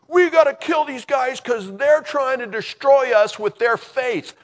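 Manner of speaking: high, very loud acted outrage; frantic mob shout. Mob rallying to stone the faithful, frantic angry shout. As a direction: shout like a panicked, furious crowd. Voices the mob picking up rocks.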